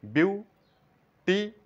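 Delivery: বিউটিফুল said very slowly, split into বিউ, টি, ফুল; syllable by syllable. Shown as a demonstration. Teacher counting syllables aloud for students, very slow. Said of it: "এখানে তিনবার উচ্চারণ করলাম".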